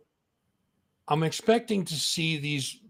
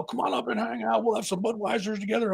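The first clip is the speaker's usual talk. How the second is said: higher, fast stage-character invitation; 7 semitones higher, 4 dB quieter, faster than usual. Retold or imitated. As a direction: tease the DJ in full monster persona. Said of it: "odorous mode".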